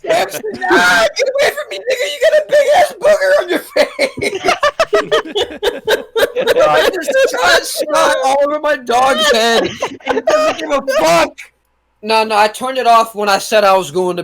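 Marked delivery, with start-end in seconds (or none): 0.0-11.4 high-pitched, laughing through every word; real helpless laughter, near tears; laughing hard. Real, not acted. Laughing at his dog Samson covered in snot, high helpless laugh.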